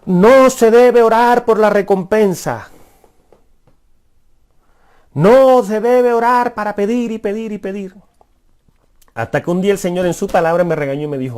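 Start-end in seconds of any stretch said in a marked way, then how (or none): 0.1-7.9 preaching at the people. Retold or imitated. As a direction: thunder it from the pulpit like a young priest. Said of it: "Entonces le decía a la gente".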